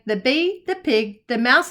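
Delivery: ordinary delivery, nothing marked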